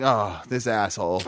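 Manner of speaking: low, slow grumble of resentment; bitter devil. Acted. Devil resenting God's new rule, bitter. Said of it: "he's gotta be a little bit bitter".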